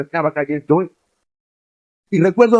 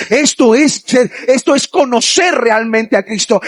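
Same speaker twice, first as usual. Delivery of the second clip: preaching at full force; 6 semitones higher than usual. Acted. Pastor urging the young people, building to a shout.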